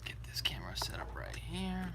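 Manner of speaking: hushed whisper to self. Real, not acted. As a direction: whisper it under your breath. Setting up camera, whispered.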